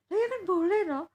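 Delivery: put-on annoyed child voice. Granddaughter insists to grandpa he may buy it. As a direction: whine it like a fed-up kid.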